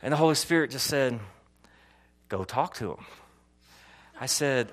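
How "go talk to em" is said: low, soft voiced prompting; hushed inner prompting. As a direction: voice the Spirit's quiet nudge. Holy Spirit's prompting voiced in story.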